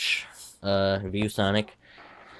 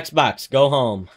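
loud voice command; 4 semitones higher, 6 dB louder, much slower than usual. Real, not acted. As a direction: call the command out loud and high. Calling out a command to Xbox.

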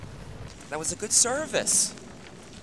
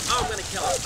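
usual speaking voice

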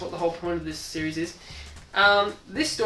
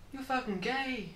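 usual speaking voice

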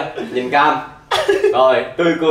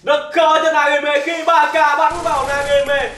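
play-by-play commentary; 8 semitones higher than usual. Acted. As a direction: build tension like a commentator. Live play-by-play of the game. (giọng bình luận viên)

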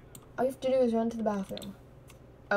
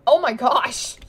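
excited; 2 semitones higher, 9 dB louder, slower than usual. Real, not acted. Excited reaction to game.

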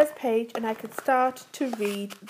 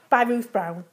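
ordinary delivery

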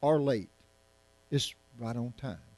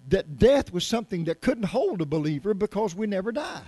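higher, louder preaching build; fiery preaching; 5 semitones higher, 5 dB louder than usual. Real, not acted. Preacher pressing the congregation on Jesus's anger, loud and high.

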